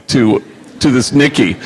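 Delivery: ordinary talk, nothing marked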